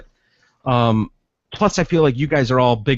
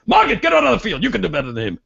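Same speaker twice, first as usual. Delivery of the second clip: mock cranky fan; 6 semitones higher, much faster than usual. Cranky fan yells at wife Margaret.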